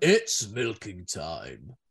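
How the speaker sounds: very slow, drawn-out leering line; leering owner voice. Retold or imitated. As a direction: stretch it out slow and sleazy. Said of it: "is what he says".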